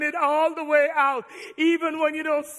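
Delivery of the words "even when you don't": usual speaking voice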